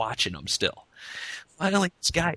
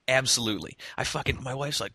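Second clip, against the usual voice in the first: normal talking voice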